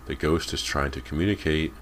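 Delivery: ordinary delivery, nothing marked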